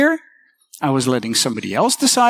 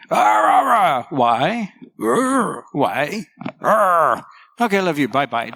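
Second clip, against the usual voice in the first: put-on zombie and toddler; slower than usual. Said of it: "And Mindy goes".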